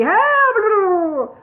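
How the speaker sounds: high, loud drowning yell; gurgled cry for help. A narrator's reading. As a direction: shout for help as water fills the mouth. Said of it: "gurgled Tori".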